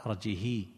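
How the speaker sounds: "أرجه" ending in a long hee; arji-hee for "أرجه", without glottal stop; lengthened kasra. Shown as a demonstration. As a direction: stretch the final i of "أرجه". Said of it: "إشباع الكسرة".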